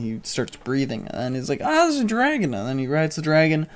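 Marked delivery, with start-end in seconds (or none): 1.6-2.5 surprised. Retold or imitated. Hero sees the dragon.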